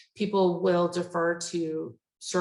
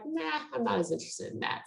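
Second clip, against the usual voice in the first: higher, softer, quick brush-off; mock disinterest; 6 semitones higher, 5 dB quieter, faster than usual. Slacker classmate begging off a group project, mock disinterested. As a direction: imitate a classmate casually opting out.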